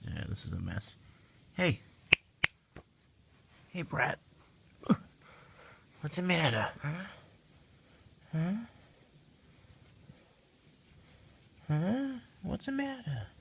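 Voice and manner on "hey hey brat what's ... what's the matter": high, soft coaxing voice to a cat; high cooing at the pet; pet talk. Real, not acted. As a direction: coax the cat gently, way up high.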